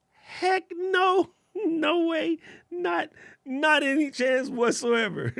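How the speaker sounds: a squealing high falsetto no, half laughing; mock high squeal. Answering a viewer about his camera in a high mock squeal. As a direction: squeak out a horrified comic refusal.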